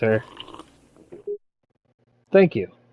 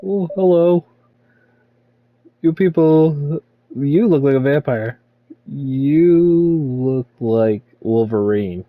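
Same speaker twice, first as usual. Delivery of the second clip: lightly voiced greeter; 3 semitones higher, 3 dB louder, slower than usual. Player voicing customer characters.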